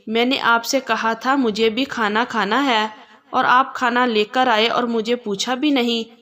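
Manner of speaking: ordinary delivery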